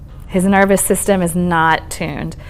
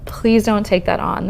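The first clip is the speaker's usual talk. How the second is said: ordinary talk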